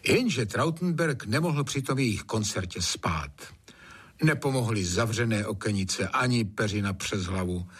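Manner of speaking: usual speaking voice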